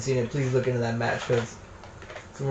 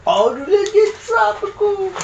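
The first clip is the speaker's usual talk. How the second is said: high silly voice; slower than usual. Pretend. Mock reaction to candy flavor.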